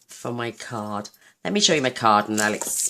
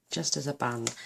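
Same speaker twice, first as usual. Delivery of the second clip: ordinary delivery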